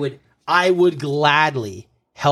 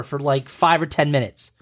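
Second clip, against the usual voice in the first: ordinary delivery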